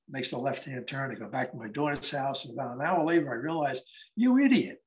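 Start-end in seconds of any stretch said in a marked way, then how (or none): 4.2-4.8 high, louder burst of self-reproach; exasperated retold self-rebuke; self-scolding. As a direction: kick yourself for forgetting. Scolds himself in retold thought.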